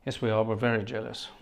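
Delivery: normal talking voice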